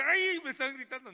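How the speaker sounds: high, loud yelp of alarm; hammed-up nervous outcry; mock startled fright. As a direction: play someone jumpy scared by shouting. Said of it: "Él no se asusta, dice".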